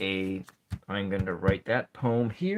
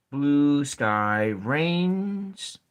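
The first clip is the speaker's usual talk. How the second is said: "reigns" drawn out as if two syllables; slow syllable count; 4 semitones higher, 4 dB louder, much slower than usual. Shown as a demonstration. Teacher checking if "reigns" has two syllables, drawn out. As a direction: stretch "reigns" long and slow. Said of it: "maybe this is just one syllable".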